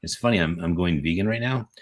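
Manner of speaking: ordinary delivery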